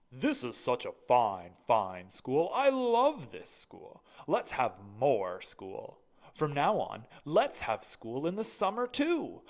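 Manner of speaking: loud, slow, proud school principal; booming headmaster. Acted. Mister Keene's voice.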